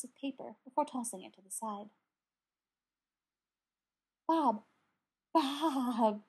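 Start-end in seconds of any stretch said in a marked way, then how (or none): 4.3-6.2 breathless. A narrator's reading. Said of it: "Brendan breathed".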